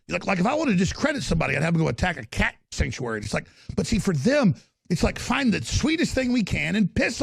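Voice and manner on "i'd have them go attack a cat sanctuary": ordinary talk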